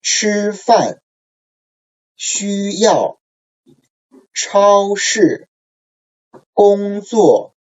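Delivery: 吃饭, 需要, 超市, 工作, 生日, 空气 each high level, then sharply falling; careful tone drill, high sustained syllable into a sudden fall; first tone plus fourth tone. Shown as a demonstration. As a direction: model each word for repetition, first syllable held high, second dropping. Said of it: "the first tone plus the fourth tone".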